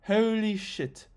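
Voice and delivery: put-on slow, drawn-out South African accent; a light South African accent stretching out Holy shit; mock South African accent, drawn out slowly. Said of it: "That's my, uh, South, South African accent".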